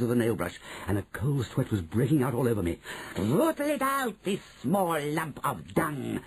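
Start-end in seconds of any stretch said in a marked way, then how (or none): 3.2-6.2 high witch screech, dropping into relish. Acted. Grand High Witch urges the hunt, screeched order. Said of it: "screeched the Grand High Witch".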